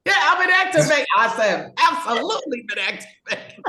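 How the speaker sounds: high, loud burst of excitement; exclaiming with real energy; fired up. Real, not acted. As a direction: belt it out like you're on fire. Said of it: "You sound like you've been activated".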